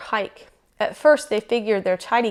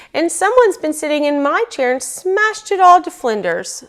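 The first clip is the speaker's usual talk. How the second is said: high, louder bear cub squeak; squeaky bear cub; 7 semitones higher, 5 dB louder than usual. A narrator's reading. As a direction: squeak it out as an upset little cub. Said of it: "squeaked the bear cub".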